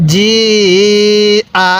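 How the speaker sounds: long steady "জি", then short "আ"; four-alif stretch. Shown as a demonstration. Teacher showing learners the hamza rule, long "জি", short "আ". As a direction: stretch "জি" out and land briefly on "আ". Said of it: "এরকমভাবে শিখব".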